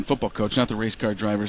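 ordinary talk

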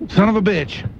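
startled curse replay. Real, not acted. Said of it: "Play that again".

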